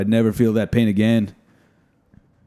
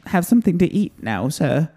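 higher meek little elf asking; timid elf; 6 semitones higher than usual. Pretend. Host voicing a meek elf asking for food. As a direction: ask shyly like a hungry little elf. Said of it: "he's like".

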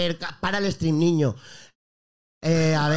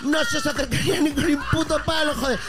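higher-pitched mock streamer rant with a Galician accent; Chocas impression; 4 semitones higher than usual. Streamer mocking his Twitter critics, higher-pitched parody rant. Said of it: "esto es el discurso del Chocas".

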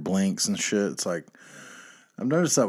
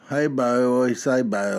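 louder, mocking good-ol'-boy Southern greeting; mocking fake Southern drawl; 6 semitones higher, 7 dB louder than usual. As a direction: sneer at the fake country act in a Southern accent. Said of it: "you're trying to act like you're real country".

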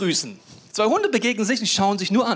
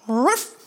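one short woof, pitched up; imitated dog bark; 4 semitones higher, 4 dB louder than usual. Shown as a demonstration. Showing that dogs understand intonation, imitated bark. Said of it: "und Betonung".

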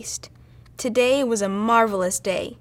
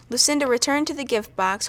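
ordinary delivery, nothing marked

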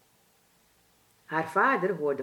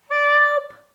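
a louder, high squeaky cry of help; mouse squeak for help; 10 dB louder than usual. A narrator's reading. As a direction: piep nog luider om hulp, hoog en bang. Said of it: "piepte hij heel zachtjes". (luider, hoog piepend hulpgeroep)

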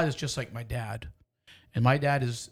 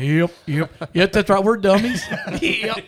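loud laughing agreement; 6 semitones higher, 9 dB louder than usual. Real, not acted. Laughing agreement about being dummies.

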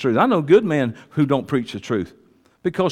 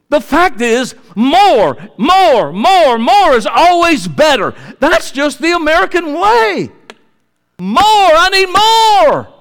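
loud, slow, drawn-out cry of more; preaching shout; 9 semitones higher, 7 dB louder, slower than usual. Real, not acted. Preacher at the pulpit, mocking greed, in a big slow shout. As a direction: hammer the word more like a greedy crowd. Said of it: "I was just gonna try to talk today".

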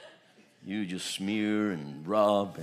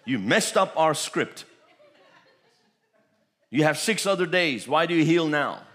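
indignant leaders; 6 semitones higher, 6 dB louder than usual. Retold or imitated. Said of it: "went into the synagogue".

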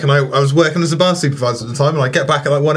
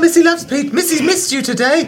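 high cutesy voice; third-person girlfriend; 12 semitones higher than usual. Retold or imitated. Mimicking his clingy ex-girlfriend, high cutesy coo. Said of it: "refer to herself in the third person".

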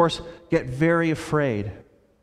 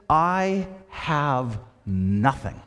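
slow solemn statement; 3 semitones lower, much slower than usual. Acted. Jesus voiced, solemn.

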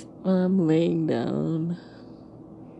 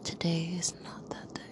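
whispered quote; 9 dB quieter than usual. Shown as a demonstration. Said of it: "I said the quote".

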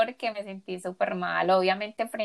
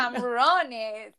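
put-on slow gringo-accented camarones; American accent Spanish; 4 semitones higher, much slower than usual. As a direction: say it like a bad gringo. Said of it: "con acento gringo, pero malo".

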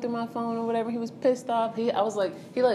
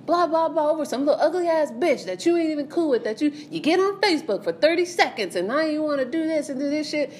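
pitched-up imitation of a ranting boyfriend; mock angry rant; 8 semitones higher than usual. Boyfriend ranting over a girl on Facebook, mock heated. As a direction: rattle it off like his nagging.

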